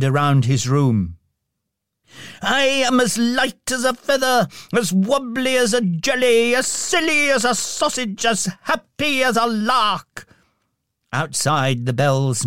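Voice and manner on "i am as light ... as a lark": put-on giddy old man. A narrator's reading. Giddy Scrooge prances.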